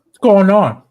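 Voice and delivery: talking while chewing; words around a bite of dinner; mouth full. Real, not acted. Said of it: "what are you munching?".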